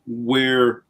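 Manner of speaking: ordinary delivery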